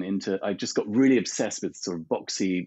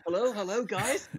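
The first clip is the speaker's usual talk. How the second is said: phone voice; 10 semitones higher than usual. Retold or imitated. Mock phone call hello.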